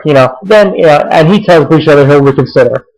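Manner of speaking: ordinary delivery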